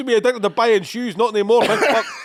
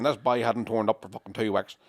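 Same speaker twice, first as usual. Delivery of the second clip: put-on wrong Irish accent; 9 semitones lower, 6 dB quieter than usual. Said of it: "I know I'm doing the wrong accent".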